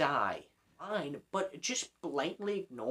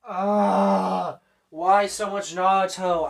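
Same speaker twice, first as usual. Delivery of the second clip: exasperated groan; 9 dB louder, much slower than usual. Real, not acted. Exasperated outburst at anime talk.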